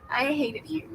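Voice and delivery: choked-up and quiet after crying; real tears still in the voice; tearful. Real, not acted. Friend moved to tears by a book, quiet and tearful. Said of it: "I cried for five hours".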